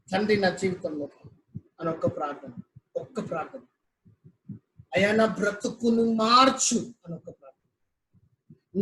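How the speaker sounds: ordinary talk, nothing marked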